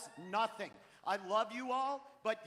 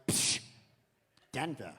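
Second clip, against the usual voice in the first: scoffing puff, then low disdain; scoff, then drop the word low; dismissive aunt; 9 semitones lower than usual. Retold or imitated. Aunt dismissing nephew's new city, scornful scoff. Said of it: "And my aunt was like".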